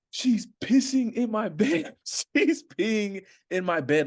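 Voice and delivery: high, slow, strained panicked cry; imitated panic. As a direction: act out a friend's horrified panic. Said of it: "Trent's panicking".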